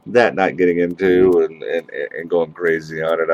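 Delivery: usual speaking voice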